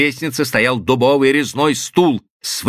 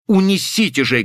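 higher-pitched, insistent command; impatient order; 8 semitones higher than usual. A narrator's reading. Husband ordering servants to carry the woman out, impatient. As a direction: press the order on them urgently.

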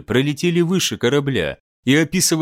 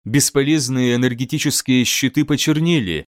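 ordinary delivery

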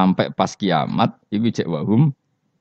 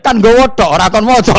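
very high, loud comeback, laughing; cheeky shouted retort; 14 semitones higher, 11 dB louder than usual. Acted. Umar mocking the angels with a shrill laughing yell. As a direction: crow it back, laughing.

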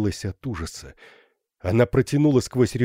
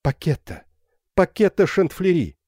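raised call, higher at the end; 8 semitones higher, 3 dB louder than usual. A narrator's reading. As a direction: call the name through the window. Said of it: "окликнула затворницу".

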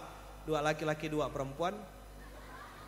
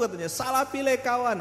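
mock shout; 6 semitones higher than usual. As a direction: shout the complaint like a wronged drum. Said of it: "dia teriak-teriak".

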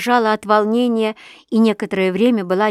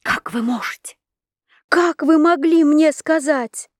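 anguished cry; 8 semitones higher, 4 dB louder than usual. A narrator's reading. She cries out in anguish.

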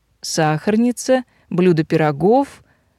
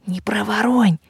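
unvoiced confidential whisper; 3 semitones higher than usual. A narrator's reading. Heroine slipping Victor a warning, whispered. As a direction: whisper a quick private warning. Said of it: "шепнула я Виктору".

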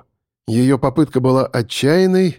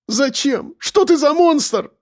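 very high, impassioned exclamation; crying out; 13 semitones higher, slower than usual. A narrator's reading. Captive asks the monster why, crying out. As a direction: cry out, voice tearing from the chest. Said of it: "воскликнула Яна".

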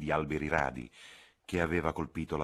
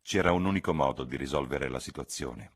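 ordinary delivery

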